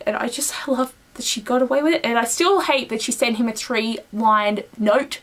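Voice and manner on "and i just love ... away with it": whispered aside. Real, not acted. Whisper continues.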